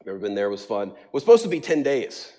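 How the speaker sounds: ordinary talk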